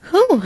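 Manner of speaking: loud breathless whew. Real, not acted. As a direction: puff out a tired whew after rushing. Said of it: "Still out of breath".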